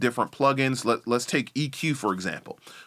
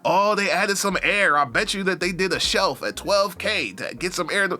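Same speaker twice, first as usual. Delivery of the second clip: nerdy engineer; 6 semitones higher than usual. Retold or imitated. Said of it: "We're the only ones who care about".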